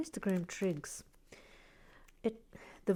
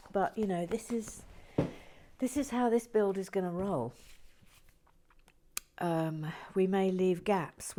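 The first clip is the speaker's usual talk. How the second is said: normal talking voice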